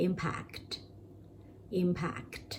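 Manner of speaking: impact repeated as a model; model pronunciation. Shown as a demonstration. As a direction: repeat impact clearly for learners. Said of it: "stress อยู่ที่".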